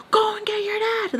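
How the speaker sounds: high screechy mock scream; retold scream. Repeating the order to get dad, retold high scream. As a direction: order screamed far up in pitch. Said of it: "I'm just, like, screaming".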